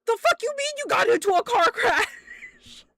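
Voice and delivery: high, loud, incredulous shout; re-enacted shouted demand; outraged yell. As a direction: yell it back at the caller in disbelief. Acted outrage at brother's phone call.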